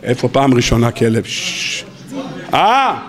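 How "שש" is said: soft unvoiced shh to quiet them; shushing. Real, not acted. Rabbi hushing students who call out answers, soft shh. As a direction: hush the room.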